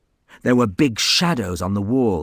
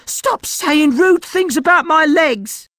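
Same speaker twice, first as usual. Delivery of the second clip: high loud indignant yell; 6 dB louder than usual. Acted. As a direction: yell in protest. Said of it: "He was going to yell".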